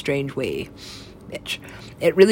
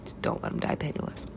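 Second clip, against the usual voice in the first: breathy whisper, no voice; 11 dB quieter than usual. Retold or imitated. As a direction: whisper the plea under the breath. Repeated plea, whispered take.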